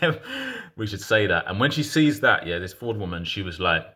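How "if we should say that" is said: hesitant nudge. Shown as a demonstration. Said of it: "but a little bit like".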